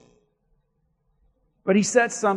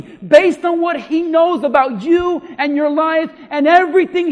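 loud, rising preacher's cadence; preaching at full force; 8 semitones higher, 11 dB louder than usual. Acted. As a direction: drive each "right" home to the congregation. Preacher builds on God's tools.

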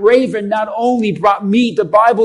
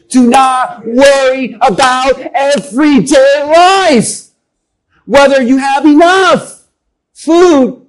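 high, loud and slow, building to a cry on food; a preacher crying out Jesus's command to the congregation; preaching shout; 4 semitones higher, 6 dB louder, slower than usual. Acted. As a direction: thunder Jesus's words at the church. Preacher voicing Jesus at full force.

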